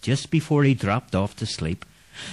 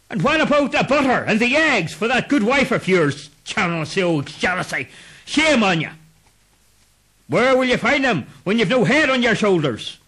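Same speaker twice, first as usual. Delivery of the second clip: scolding ghost; 10 semitones higher than usual. Acted. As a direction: shame him. Headless men scold Clancy.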